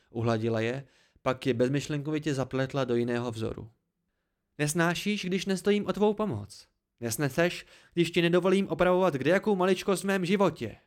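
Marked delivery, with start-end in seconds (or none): 4.6-10.8 raised, higher, indignant voice; accuse, climbing higher as it goes; angry accusation. A narrator's reading. Narrator rejects Dena's help, angry accusation. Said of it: "v očích zuřivost".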